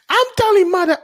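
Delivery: very high whiny British brat; spoiled child tattling to mother; mock British accent. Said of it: "The accent".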